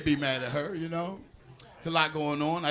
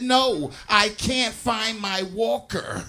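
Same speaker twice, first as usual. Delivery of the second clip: drawn-out, slow punchline; slow, lightly voiced elderly lady; slow old lady; 2 semitones higher, much slower than usual. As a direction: stretch every word like a frail old woman. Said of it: "She said".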